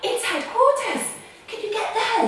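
high, loud, thrilled; loud high excitement at the radio call; excited. Acted. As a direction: gasp and announce the call eagerly. Pretend call from headquarters.

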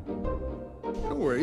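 very slow, soft, breathy calming words; soft reassurance. Acted. Instructor to nervous Sophie in the training car, a very slow, soft reassurance. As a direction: soothe a nervous learner.

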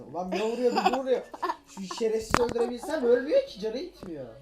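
loud, high, laughing; laughing rant. Real, not acted. Furious laughing rant at mob.

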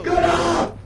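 high loud command; urgent shout. Real, not acted. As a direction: yell it urgently.

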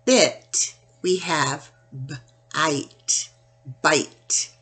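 teaching the long vowel; sounding out. Shown as a demonstration. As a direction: slowly stretch b-ite into bite. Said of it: "instead of bit".

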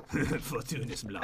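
very low, hushed mock incantation; quiet, deep wizard's spell. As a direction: murmur the spell softly, deep down in the voice. Repeats spell until told to stop.

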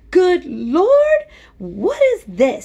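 high, loud alarmed outburst; startle, then demand an answer; alarmed doctor. A narrator's reading. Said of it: "started in actual alarm".

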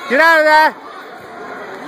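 slow, stretched-out call; drawn out. Real, not acted. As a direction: drag the words out slowly. Coach calling to fighter.

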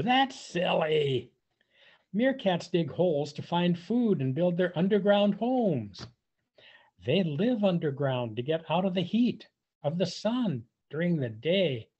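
ordinary delivery

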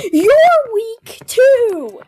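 slow, high, loud jeer; taunting yell. Acted. Character jeering at her sister, slow loud taunt. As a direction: drag out the insult loudly.

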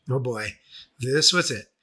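ordinary talk

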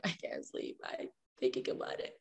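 breathy late-night whisper to herself. Retold or imitated. Lying in bed, guilt-ridden, whispering to herself. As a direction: whisper it like you can't sleep for guilt.

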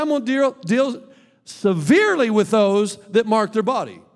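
loud, high pulpit proclamation; stern divine warning. Acted. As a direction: thunder it out as God's warning. Quoting God's stern warning.

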